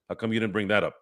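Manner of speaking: ordinary talk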